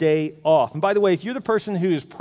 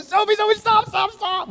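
very high, frantic mock sideline scream; reenacted parent's scream, pitched way up; imitated parent screaming; 14 semitones higher than usual. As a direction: call out in high-pitched panic to halt a player. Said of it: "like, screaming, going".

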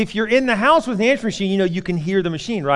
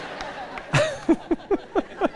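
breathy laugh; 6 dB quieter than usual. Real, not acted. Laughing at own story.

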